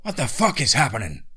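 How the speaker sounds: loud, high-pitched demand; alarmed shout. Acted. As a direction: call out urgently over gunfire. Character calls out under attack.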